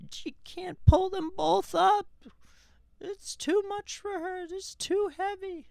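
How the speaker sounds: very high, hammed-up strain. Recounting a film's cliff scene, mock desperate strain. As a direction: play up the desperate struggle in a squeaky-high pitch. Dramatizing mom hanging on at cliff.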